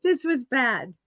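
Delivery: a touch of a cry in it; regretful. Real, not acted. Telling fans about the dead bunny, regretful. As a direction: admit it with real regret.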